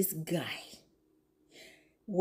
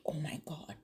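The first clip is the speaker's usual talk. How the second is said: breathless whisper of amazement; a hushed oh my God; awed whisper; 2 semitones lower, 11 dB quieter than usual. Real, not acted. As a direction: whisper it like you can't believe it. Whispered awe at the bishop.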